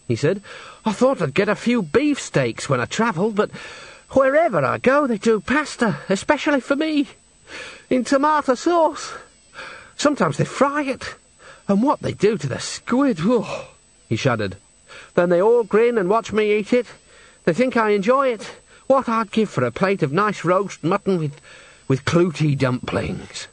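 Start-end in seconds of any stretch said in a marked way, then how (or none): 0.8-13.7 much higher-pitched, plaintive complaint; despairing singer Henry. A narrator's reading. Henry bemoans endless pasta to the witches, plaintive high character voice. 15.1-23.5 higher-pitched plaint, slowing and dropping at the end; homesick singer Henry. A narrator's reading. Henry longs for mutton and dumplings, plaintive character voice.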